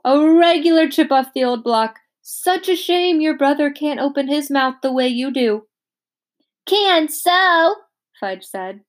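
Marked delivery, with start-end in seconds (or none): 0.1-5.7 higher, louder hammed-up praise; dentist voice. A narrator's reading. Dentist praising Peter to trick Fudge, hammed-up. 6.7-7.8 put-on high, louder little-kid insistence; toddler voice. A narrator's reading. Little Fudge insisting to the dentist, high and stubborn.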